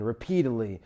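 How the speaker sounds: usual speaking voice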